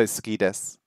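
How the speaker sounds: ordinary delivery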